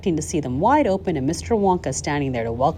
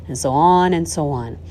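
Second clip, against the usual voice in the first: ordinary delivery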